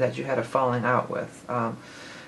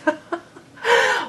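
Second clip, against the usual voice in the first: surprised laugh; 5 dB louder than usual. Real, not acted. Surprised by the wrong song.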